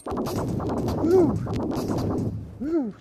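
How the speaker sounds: high, loud excited hoot; excited woo. Real, not acted. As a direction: whoop it out with glee. Cheering at the anomaly.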